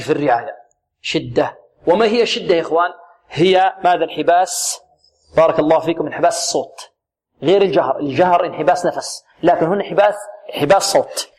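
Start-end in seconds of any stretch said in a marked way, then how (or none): none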